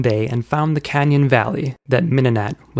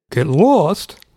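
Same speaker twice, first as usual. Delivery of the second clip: raised, higher-pitched guard's call; guard crying out; 5 semitones higher, 4 dB louder than usual. A narrator's reading. Guard cheering uncle as he exits, cried out. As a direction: call it out as a farewell. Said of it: "cried a guard".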